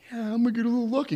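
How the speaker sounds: high, fast, quietly eager; high quick hopeful aside; eager thought. Retold or imitated. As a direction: rattle it off hopefully.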